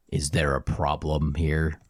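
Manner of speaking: low, slow challenge; big bouncer's low, deliberate warning; menacing Minotaur. Acted. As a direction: drop your voice and draw the question out. Minotaur confronts brawlers in bar.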